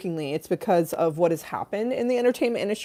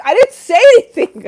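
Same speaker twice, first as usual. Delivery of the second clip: high, loud indignant yell; hammed-up angry boss denying it; angry denial; 15 dB louder than usual. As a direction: snap back that you said nothing. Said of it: "Dan was so angry".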